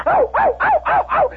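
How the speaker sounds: very high, frantic dog yelps; yelping hound imitation. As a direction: yelp like a pack of excited hounds. Imitates hounds grabbing the ham.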